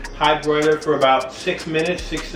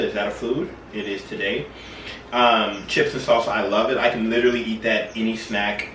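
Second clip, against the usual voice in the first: ordinary talk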